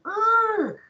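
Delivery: "U" squeezed in the throat, like a pressed E; long "U" made as E with throat pressure; pressed vowel. Shown as a demonstration. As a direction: press the sound inside for "U". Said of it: "like pressing sound".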